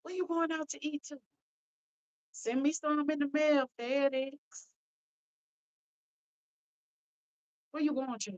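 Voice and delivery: high, bright teasing questions to a viewer; teasing a friend from the live chat, high and playful. Real, not acted. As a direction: tease her about going out to eat.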